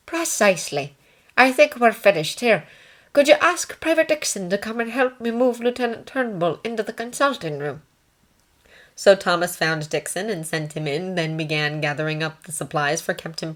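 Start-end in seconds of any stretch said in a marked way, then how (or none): 0.1-7.8 high-pitched nursing sister; ward sister. Acted. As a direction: pitch it well up as a composed sister.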